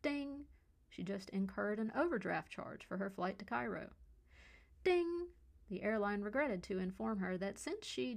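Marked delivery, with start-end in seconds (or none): 0.0-0.5 high, ringing voiced "ding"; phone notification ding. A narrator's reading. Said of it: "Leah's cell dinged with a flurry". 4.8-5.3 high, ringing voiced "ding"; phone notification ding. A narrator's reading. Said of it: "Leah's cell dinged with a flurry".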